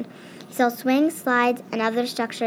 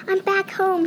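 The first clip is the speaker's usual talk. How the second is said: high excited squeak; a mouse relieved to be home, squeaking; 8 semitones higher than usual. Acted. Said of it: "he squeaked in excitement".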